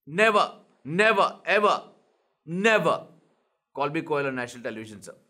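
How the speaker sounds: each never hammered, the rest rushed low; mock-stern warning to the guest; stress on never. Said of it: "Sir, request you not to call me Koel".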